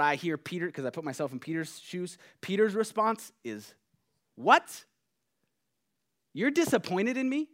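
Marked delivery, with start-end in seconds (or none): 4.3-7.5 high-pitched, incredulous comeback; indignant disbelief. Acted. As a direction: throw it back, stung and disbelieving. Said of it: "in that attitude, my response".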